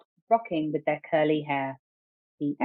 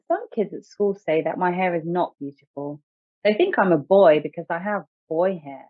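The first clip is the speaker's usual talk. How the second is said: ordinary delivery, nothing marked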